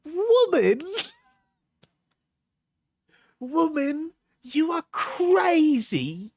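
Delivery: very high, hammed-up British exclamation; put-on accent. Reacting to "you are crazy", high theatrical British accent. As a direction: squeal it in a drawn-out mock British accent. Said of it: "when I'm doing the accent".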